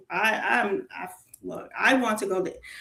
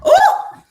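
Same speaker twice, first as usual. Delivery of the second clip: much higher and louder ooh of disgust; exasperated ooh; 11 dB louder than usual. Real, not acted. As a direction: let out a shrill-free but loud ooh of annoyance. Recalling a hated supervisor.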